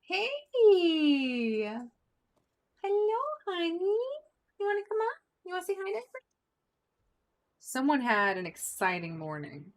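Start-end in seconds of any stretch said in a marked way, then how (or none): 0.0-6.2 pet talk. Real, not acted. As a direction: coo at the cat, much higher. Greeting pet cat.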